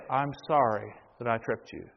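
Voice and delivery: low, soft apology; subdued apology. Shown as a demonstration. Child apologizing for tripping another, subdued and low. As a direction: offer it humbly, voice dropped and softened.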